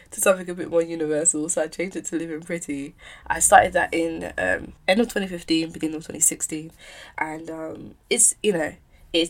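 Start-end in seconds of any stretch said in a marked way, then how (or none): none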